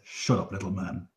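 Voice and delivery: a warrior silencing the host with quiet contempt; cold dismissal. Acted. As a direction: put the little man down coldly. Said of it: "he says".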